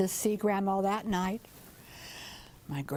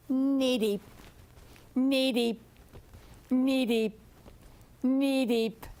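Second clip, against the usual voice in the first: lightly croaked reply; a hint of a mid-sized frog croaking back; bigger frog answer; 2 semitones higher, slower than usual. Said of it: "they call back and they tell him".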